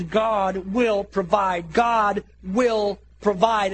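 very slow, weighty and drawn out; repeated declaration. Acted. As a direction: declare it word by word, building in force. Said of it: "his declaration".

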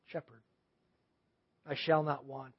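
low, soft reading to oneself; quiet meditative reading. Shown as a demonstration. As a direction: read quietly, as if pondering. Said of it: "you're slowing quietly through it".

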